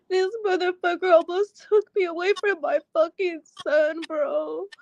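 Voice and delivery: tearful, fast and raw; sobbing through anger; crying. Real, not acted. Crying over nearly losing her son.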